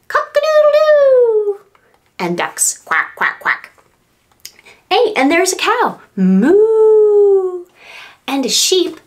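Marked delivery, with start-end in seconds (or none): 0.1-1.6 very high, breathy rooster crow. Pretend. Teacher doing the rooster for the children, very high. 2.2-3.7 duck noise. Pretend. Duck sound. 6.2-7.7 high, loud playful moo; cow moo. Pretend. Teacher mooing for toddlers, high and loud.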